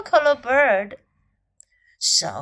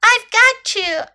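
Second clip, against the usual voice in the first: high, loud reassuring shout; 9 semitones higher, 7 dB louder, slower than usual. A narrator's reading. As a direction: shout it high, drawing out the repeat. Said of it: "Snake shouted to Little Elephant".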